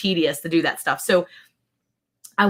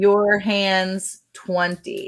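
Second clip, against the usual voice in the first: slow code read; much slower than usual. Real, not acted. Spelling out discount code slowly.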